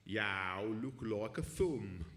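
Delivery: put-on slow, drawn-out Birmingham accent; stretched-out Brummie heckle. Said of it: "this only works in a Birmingham accent".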